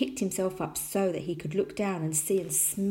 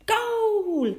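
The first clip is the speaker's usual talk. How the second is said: high, loud, long goal cry; triumphant shout; 6 dB louder than usual. A narrator's reading. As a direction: holler it like a scorer. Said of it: "he shouted".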